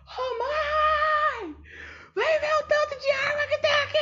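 put-on very high, loud child shout; little girl yelling. Toddler on the roof calling mom about the guns, high and loud. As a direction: squeal like an excited toddler on the roof. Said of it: "E eu ficava gritando assim".

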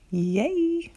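high joyful cheer; excited cheer. Real, not acted. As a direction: celebrate with a happy shout. Celebrating new believer.

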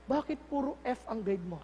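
put-on low, soft question to a child; gentle parent. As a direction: ask the child calmly and quietly. Parent calmly asks child after praying. (mahinahong magulang)

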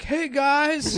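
high, loud mock greeting; casual friend greeting. Caught friend greeting his visitors, high shameless shout. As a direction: call out brightly, imitating the friend. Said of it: "be like".

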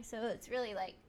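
normal talking voice

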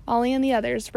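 ordinary delivery

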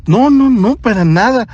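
a hint of a nervous young woman, quick denial; flustered nurse. Flustered nurse denying discomfort.

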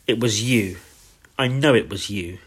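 ordinary delivery

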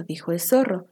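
ordinary delivery

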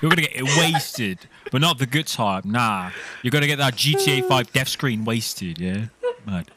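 lads' banter in a fake Liverpool accent; cheeky roadman Scouser, lightly put on; put-on Scouse accent. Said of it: "props to you, [name]. That was good".

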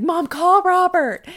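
high, loud, drawn-out shout; reenacted labor-room holler; urgent calling out. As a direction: yell to your mom for help.

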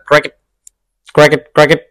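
short, chirped "cricket" with gaps between. Shown as a demonstration. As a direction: say "cricket" as a cricket would. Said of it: "that's me saying cricket as a cricket".

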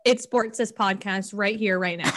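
ordinary delivery

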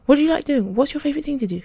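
high, excited questioning; bright-eyed, eager boyish curiosity. A narrator's reading. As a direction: ask eagerly, pitch leaping up. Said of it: "He asked excitedly".

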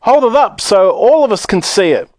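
high-pitched crowd call; shouted. A narrator's reading. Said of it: "somebody shouted".

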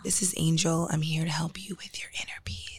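soft ASMR whisper. Pretend. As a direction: whisper calmly for inner peace. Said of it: "Oh my God, S M R".

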